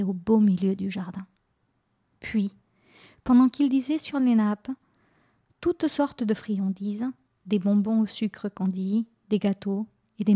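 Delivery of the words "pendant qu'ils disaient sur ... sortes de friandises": usual speaking voice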